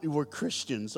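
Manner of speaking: usual speaking voice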